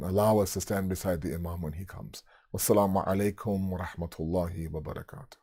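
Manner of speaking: low, soft, subdued supplication; closing prayer. Real, not acted. Preacher asking Allah to accept their worship, subdued prayer. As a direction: drop right down into a humble, hushed prayer.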